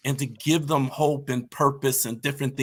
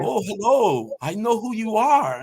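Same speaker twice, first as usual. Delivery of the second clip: higher-pitched, hammed-up German accent; horrible German accent; 5 semitones higher than usual. Man in Vegas greets a team he follows, bad German accent. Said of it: "That was a horrible German accent".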